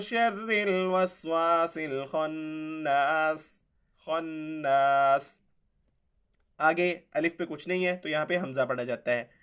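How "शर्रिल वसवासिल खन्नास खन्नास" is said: खन्नास said with a final stop on स, drawn out slowly; pause form. Shown as a demonstration. Teacher showing the pausal ending to students, slow recitation. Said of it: "और जब इस पे वक्फ कर देंगे तो".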